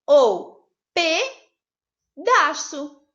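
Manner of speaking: pedaço said very slowly with a pause at the split; syllable-split pedaço. Shown as a demonstration. Teacher showing the second way to split pedaço, very slowly. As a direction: stretch pedaço out and break it into two parts. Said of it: "duas opções".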